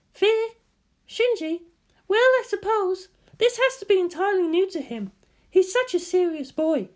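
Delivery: put-on a woman fondly puzzling over a serious boy; voiced woman.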